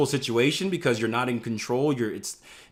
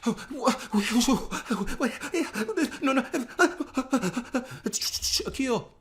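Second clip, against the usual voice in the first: high, panicky babble; mock panic over a losing trade; frantic stammer, high-pitched; panicked trader; 8 semitones higher than usual. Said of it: "you're like".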